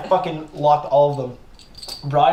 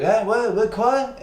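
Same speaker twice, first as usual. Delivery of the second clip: a light imitated French accent, higher; 7 semitones higher, slower than usual. French neighbor echoing the greeting, light French accent. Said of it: "he speaks with a very thick French accent".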